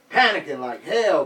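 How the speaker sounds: ordinary delivery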